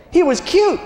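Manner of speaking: high teasing rise; high, playful lilt on cute. Acted. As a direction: say cute like a cooing aside. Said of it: "Let me translate that".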